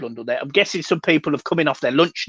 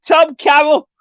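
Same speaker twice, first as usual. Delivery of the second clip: calling out; 11 semitones higher, 8 dB louder than usual. Real, not acted. Calling out a missing viewer.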